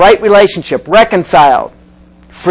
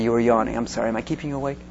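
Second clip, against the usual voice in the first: ordinary delivery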